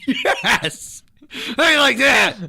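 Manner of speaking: high, loud gloating yell; loud, gloating triumph over a kid; gloating shout. Retold or imitated. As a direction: crow over the kid you just hit. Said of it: "I was like".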